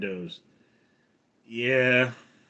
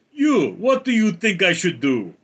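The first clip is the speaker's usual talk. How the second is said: put-on higher, louder Russian-accented demand; Putin; 6 semitones higher, 5 dB louder than usual. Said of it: "and he goes".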